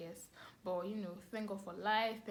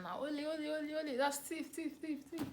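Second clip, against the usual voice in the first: high chant of ole and thief, like a Nigerian crowd; retold neighbours yelling thief, pitched up; mock crowd shouting; 7 semitones higher than usual. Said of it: "And they were shouting".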